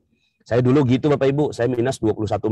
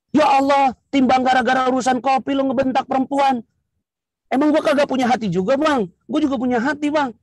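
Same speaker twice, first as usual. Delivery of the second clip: high, sassy Jakarta-slang retort; wife talking back hotly to her husband; indignant wife; 12 semitones higher than usual. Acted. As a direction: fire back at him, offended.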